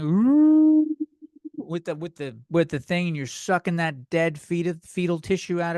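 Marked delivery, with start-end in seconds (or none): 0.0-0.9 high, loud vacuum hoot; long high-pitched "ooh" like a suction machine; imitated suction noise. Shown as a demonstration. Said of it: "I made a noise that could only sound like".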